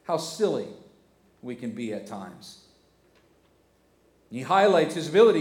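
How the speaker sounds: usual speaking voice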